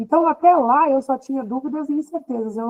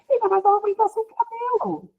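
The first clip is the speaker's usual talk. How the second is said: retold motherly questioning; 9 semitones higher than usual.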